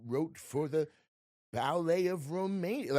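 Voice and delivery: slow, halting, refined imitation of an actor; John Malkovich impression. Veteran actor recalling his youth, slow and refined. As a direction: savor each phrase like a cultured veteran. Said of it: "you talk like you were born in a vineyard".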